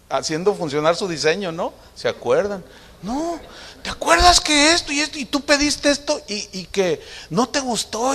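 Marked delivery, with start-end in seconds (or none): 3.0-8.1 put-on high-pitched chattering child; little girl. Little girl reminds dad what happened, high child voice. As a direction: imitate a daughter eagerly piling up memories, pitched up. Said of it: "dice la niña".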